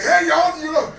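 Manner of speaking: high, loud retold holler; mock-eager announcement; excited calling out. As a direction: call out big news to everyone. Acts himself calling out excitedly.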